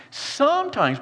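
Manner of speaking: "Sometimes" pitched high and set apart; stress on sometimes. Real, not acted. Preacher underlining one word for the congregation, emphatic "Sometimes". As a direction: punch "Sometimes" with a big lift in pitch. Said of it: "I want to emphasize the word sometimes".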